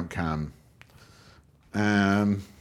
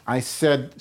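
usual speaking voice

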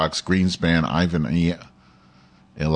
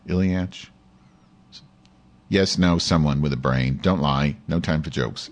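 ordinary talk, nothing marked

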